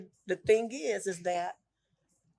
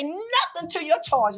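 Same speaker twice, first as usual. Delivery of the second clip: high, loud imitation of grieving hollering; retold crying out; 10 semitones higher, 5 dB louder, slower than usual. Mother retells what she kept crying to God, hollering. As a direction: belt it out high like a repeated plea. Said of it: "You just kept crying and say".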